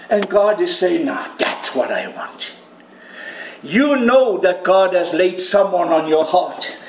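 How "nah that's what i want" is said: lower, softer whispered reply; hushed low whisper, calm resolve; low quiet voice. Acted. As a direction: answer low under your breath. Voicing God quietly.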